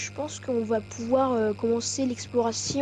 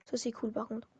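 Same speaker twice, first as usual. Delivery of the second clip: whisper; 12 dB quieter than usual. Real, not acted. Whispered aside.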